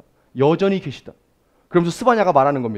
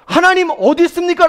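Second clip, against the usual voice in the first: much higher and louder, accusing; anguished complaint 하나님 어디 있습니까; complaining cry; 8 dB louder than usual. Retold or imitated. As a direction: cry out in reproach at God. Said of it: "라고 원망하는".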